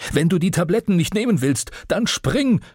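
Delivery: viel höher, sich steigernd gebrüllt; hinterhergebrüllt. Acted. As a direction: einem fliehenden Sohn nachbrüllen. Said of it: "brüllte er mir hinterher". (Vater brüllt dem Sohn hinterher, schrill und viel höher)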